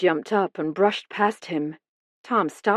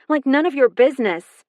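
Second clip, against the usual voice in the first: a higher, louder snap; snapped; 7 semitones higher, 5 dB louder, faster than usual. A narrator's reading. Daughter snapping back at the detective. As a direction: bite the answer off sharply. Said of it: "she snapped".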